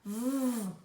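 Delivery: long sustained "V" buzz; vibrated V sound. Shown as a demonstration. Teacher showing a vibrating sound, long buzzed V. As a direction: hold "V" humming, no vowel. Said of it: "um som vibrado".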